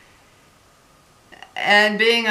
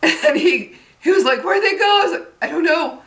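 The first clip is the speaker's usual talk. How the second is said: high, laughing through the words; giggly, high-pitched and louder; laughing retelling; 10 semitones higher, 4 dB louder than usual. Real, not acted. Laughs while retelling brother's puzzled question.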